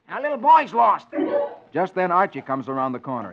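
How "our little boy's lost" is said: put-on higher, loud boy's answer; little boy. Little boy tells the baby, loud and high. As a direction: blurt it like an excited kid. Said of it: "the little boy answers".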